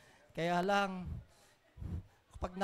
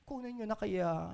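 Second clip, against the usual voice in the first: soft mock prayer with no conviction; lifeless prayer; 2 semitones lower, 6 dB quieter than usual. Preacher mimicking weak prayers for the sick, lifeless and soft. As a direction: pray limply, as if already giving up. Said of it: "Walang kabuhay-buhay ang mga panalangin".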